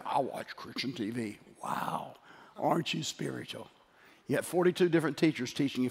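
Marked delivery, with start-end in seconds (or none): 0.0-3.7 soft, mock-impressed sarcasm; mock piety. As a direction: feign awe, quietly and drily.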